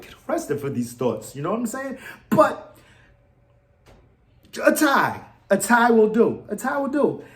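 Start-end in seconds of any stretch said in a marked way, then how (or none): none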